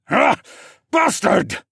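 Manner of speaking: loud high cry then curse; pained scream. A narrator's reading. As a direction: scream in pain and rage. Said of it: "Siskorn screamed".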